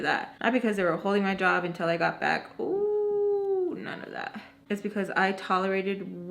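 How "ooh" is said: long, high, held "ooh"; mocking "ooh". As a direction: draw out a high, mock-spooky "ooh". Mockingly dismissing a supposed reason.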